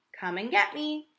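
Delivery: high, playful calling out. Acted. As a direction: tease a friend into a chase. Playful rabbit calling to friend.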